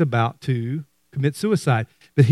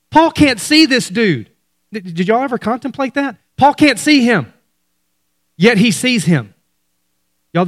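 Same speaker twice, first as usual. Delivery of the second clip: preaching; 6 semitones higher than usual. Real, not acted. Preaching at rising pitch.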